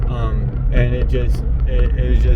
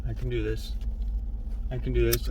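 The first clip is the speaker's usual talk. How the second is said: hushed and tense, softer throughout; real panic attack; 13 dB quieter than usual. Real, not acted. Alone in the car at the tracks, hushed and frightened. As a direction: talk yourself through a panic under your breath. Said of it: "I'm having a panic attack".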